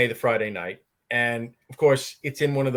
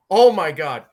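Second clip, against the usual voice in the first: loud, higher mock dismay; flustered; 4 semitones higher, 8 dB louder than usual. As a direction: imitate someone mortified by their own blunder. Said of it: "She got so flustered".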